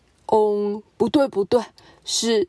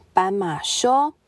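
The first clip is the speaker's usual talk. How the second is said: plain reading voice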